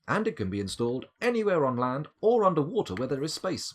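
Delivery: scanner entry read-out. Acted. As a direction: present the entry like a product blurb.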